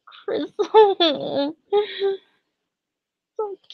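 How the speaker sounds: soft, slow, high teary cooing; crying of joy. Real, not acted. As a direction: coo over the picture while tearing up. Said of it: "Fuck, I'm crying now".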